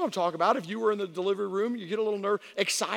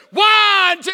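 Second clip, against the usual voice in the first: high, imitated doctor's scream; screamed counting; 11 semitones higher, 14 dB louder than usual. Doctor starting the next contraction count, imitated scream. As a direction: scream the count at full force. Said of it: "she's, like, screaming".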